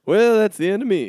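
high, loud jokey surrender; mock defeat. Friend joining the joke with a loud mock surrender. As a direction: play dead in the argument.

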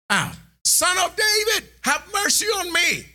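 high, pleading cry to the Son of David; shouting for mercy. Retold or imitated. Blind beggar crying out to Jesus, high pleading shout. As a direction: call out high and desperate for mercy. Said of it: "he starts shouting".

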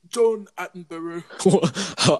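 slow hushed British documentary voice; British narrator voice. Pretend. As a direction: say it like a nature documentary host. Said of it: "he's an old British man".

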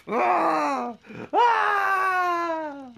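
high-pitched hammed-up pain scream; high mock scream. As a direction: shriek as if stabbed, in jest.

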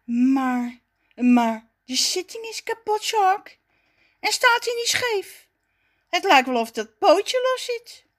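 high-voiced, halting doubt; hesitant. A narrator's reading. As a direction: raise objections carefully, one by one. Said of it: "Dan zegt Raffie aarzelend". (aarzelend, met hoge stem)

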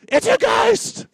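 very high, loud, slow scream of fright; panicked shriek. Shown as a demonstration. Pastor showing how the disciples really said it, panicked shriek. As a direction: shriek it like you've seen a ghost. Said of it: "They would say".

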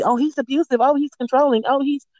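high, sing-song mockery of the accusers; mocking quote. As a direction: parrot their complaints in a scornful high voice.

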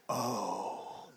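soft, low sinking "oh"; imitated dismay. Wife hearing about Detroit, quietly dismayed. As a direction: let it out deflated. Wife's stunned reaction.

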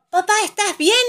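pregunta aguda y fuerte, con alarma fingida; pregunta de todos. A narrator's reading. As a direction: pregunta como los niños del cuento. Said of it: "preguntan todos". (voz aguda y apurada)

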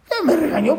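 usual speaking voice

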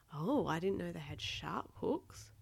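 plain reading voice

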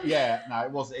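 usual speaking voice

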